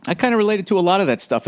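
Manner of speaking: ordinary talk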